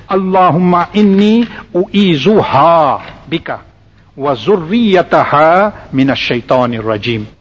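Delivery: slow recitation with a long "ha" ending "u'izuha"; slow demonstration recitation. Shown as a demonstration. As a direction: draw out the "ha" in "u'izuha" and "zurriyataha". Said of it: "That ha comes in for the female gender".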